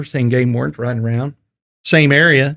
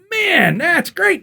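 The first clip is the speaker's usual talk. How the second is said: high-pitched, drawn-out amazement; slow, high mock awe; acted admiration; slower than usual. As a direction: play the impressed game warden, stretching the words. Game warden admiring hunter's rifle.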